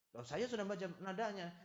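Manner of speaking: acted accusing retort; mock insistent wife. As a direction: insist like an offended spouse.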